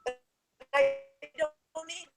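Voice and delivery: ordinary delivery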